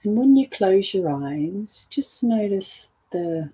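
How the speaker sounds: soft, unhurried meditation voice; softly spoken meditation cue with lingering pauses; guided meditation. Acted. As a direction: gently guide the listener inward, letting the level drop.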